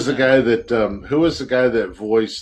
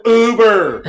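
high, loud one-word holler; mock yell; 8 semitones higher, 7 dB louder than usual. Telling drunk friends to get a ride, mock yell. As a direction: shout it as a joking send-off.

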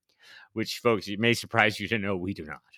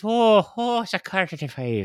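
Irish hook; 9 semitones higher than usual. Shown as a demonstration. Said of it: "my Irish hook is".

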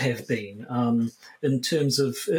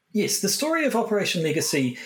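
ordinary talk

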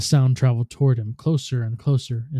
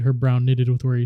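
plain reading voice

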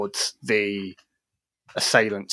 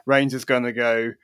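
usual speaking voice

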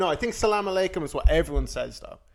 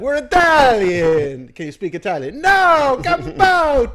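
high, loud hammed-up wiseguy voice; over-the-top fake Italian-American tough guy; mock Italian-American guys; 11 semitones higher, 12 dB louder, slower than usual. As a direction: ham up a clueless Sopranos Italian. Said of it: "They're like".

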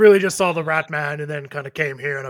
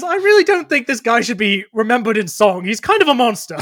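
loud, raised protest; 7 semitones higher, 10 dB louder than usual. Acted. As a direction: call it out over the song. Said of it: "He says".

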